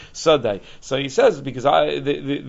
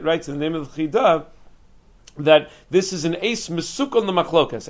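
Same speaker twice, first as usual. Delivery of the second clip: usual speaking voice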